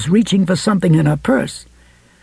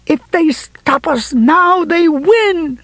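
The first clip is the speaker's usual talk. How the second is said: slow, high-pitched furious scream at moderate volume; 12 semitones higher, much slower than usual. A narrator's reading. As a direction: scream it out, stretching the words high. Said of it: "she screamed".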